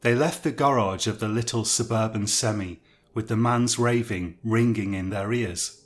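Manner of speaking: ordinary delivery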